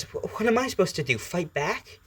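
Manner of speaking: high, quick, defensive question; Izuku. Acted. Boy defends himself to the symbiote, high and flustered. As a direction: stammer into it and push the pitch up. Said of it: "And Izuku then says".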